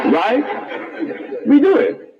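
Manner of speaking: high unvoiced laugh breaking into words. Real, not acted. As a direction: laugh through it, then blurt the words. Laughing about babies not dying.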